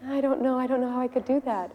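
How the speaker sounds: high, sheepish excuse; imitated embarrassment. King answering Prabhupada's challenge, embarrassed. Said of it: "he said he was very embarrassed".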